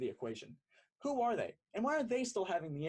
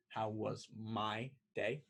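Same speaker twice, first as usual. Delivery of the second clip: slow, ominous repeat; 7 semitones lower, slower than usual. Acted. Person B repeating the question again, winding up to vent. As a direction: lower and slow, "my day" dragged out with a rising end. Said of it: "Never good when they do it twice".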